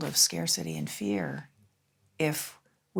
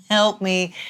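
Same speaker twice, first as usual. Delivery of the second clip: louder, higher cry for help; half-laughing plea, suddenly raised; mock plea for help; 4 semitones higher, 9 dB louder than usual. Overwhelmed mother retelling her plea after divorce, mock plea.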